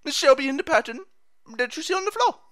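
proud mock sailor proclamation; Popeye voice. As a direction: declare it grandly like Popeye. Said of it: "it's Popeye speaking".

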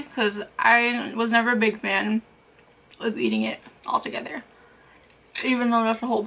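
talking between bites; chewing while talking. Real, not acted. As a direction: mouth partly full of nuts. Seen 1: Nuts held in palm throughout; puts some in mouth at 1.1 and 9.7.

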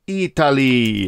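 put-on slow, drawn-out Italian-accented "Italy"; a playful stab at an Italian accent; mock Italian accent. Tutorial host announcing the last country in a mock Italian accent. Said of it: "Probably trying to do my best Italian accent".

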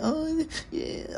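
squeaky high, soft mock coo; mock girlfriend voice. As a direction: coo it like a clingy girlfriend, mocking. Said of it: "one minute".

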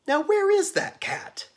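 very high, loud searching call; loud storybook where-are-you call. A narrator's reading. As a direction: sing out the question at top pitch. Calling for the hidden cat.

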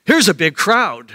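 ordinary talk

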